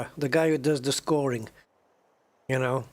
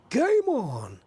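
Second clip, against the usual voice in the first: high, excited darts commentator shout; 8 semitones higher than usual. Retold or imitated. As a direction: belt it out like the darts announcer. Repeats darts commentator catchphrase.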